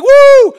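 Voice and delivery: high, loud mock-kid "woo!"; imitating a thrilled kid's cheer; excited whoop. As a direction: let out a piercing, gleeful whoop.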